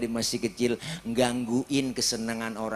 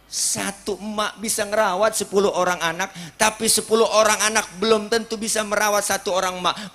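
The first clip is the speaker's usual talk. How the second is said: preaching higher, louder and faster; 7 semitones higher, 6 dB louder than usual. Acted. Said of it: "preacher repeats the saying, louder, faster".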